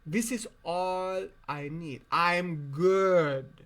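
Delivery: usual speaking voice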